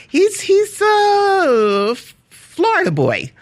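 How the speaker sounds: high-pitched, drawn-out, helpless amusement; cracking up in a high voice; laughing protest. Real, not acted. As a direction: squeal it through laughter, stretching the words. Laughing over husband's failed English accent.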